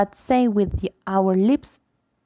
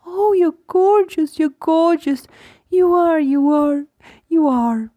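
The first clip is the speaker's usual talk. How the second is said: put-on repetitive baby talk; 8 semitones higher, 5 dB louder than usual. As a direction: coo the same words over and over. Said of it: "She said the same thing over and over".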